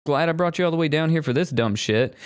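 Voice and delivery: mock inspector. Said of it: "He's like".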